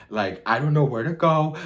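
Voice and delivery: high, loud flustered wail; mock panic. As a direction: throw it out loudly in exaggerated panic. Acted panic at his breakdown.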